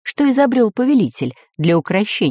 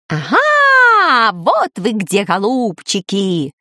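gloating call; 3 semitones higher, much slower than usual. A narrator's reading. Parrot spots his enemies, gloating call. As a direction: crow it out, stretching the words.

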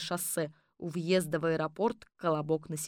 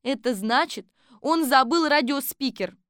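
Kolbochkina reporting; 6 semitones higher, 6 dB louder than usual. Acted.

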